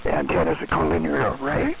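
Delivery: normal talking voice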